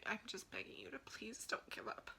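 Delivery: whispered, choked begging; begging the viewer through tears; tearful plea. Real, not acted. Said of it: "which is why I'm emotional".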